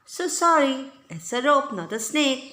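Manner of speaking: high, loud, fake sorry; mock apology. A narrator's reading. Prankster boy mocking the girls with fake apology. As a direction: pretend to be sorry, teasing. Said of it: "he said, holding it up".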